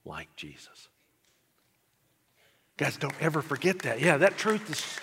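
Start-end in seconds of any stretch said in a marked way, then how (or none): 0.0-0.9 much softer and lower, reverent; almost breathe it in awe; awed hush. Retold or imitated. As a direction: reverent hushed confession of faith. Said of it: "he said".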